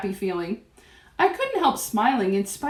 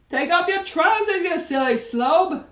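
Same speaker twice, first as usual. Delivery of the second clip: mildly raised, higher jeering call; shouting; 6 semitones higher, 3 dB louder than usual. A narrator's reading. Another cook tells him to strip, shouting. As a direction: call out at a silly fool. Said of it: "someone else shouted".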